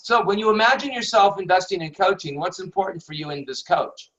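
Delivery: fast, run-together reading with no emphasis; rattled off. Shown as a demonstration. Teacher showing the question read off quickly and flatly. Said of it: "if I just read it all off".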